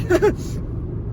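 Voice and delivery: high breathy laugh before the quote; a quick breathless chuckle; airy laugh. Retold or imitated. Laugh before Moses' line.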